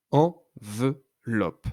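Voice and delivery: en-ve-loppe, each syllable slow and apart; teacher counting syllables, very slow; syllables split slowly. Shown as a demonstration. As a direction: clap out Enveloppe syllable by syllable. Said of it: "compter le nombre de syllabes".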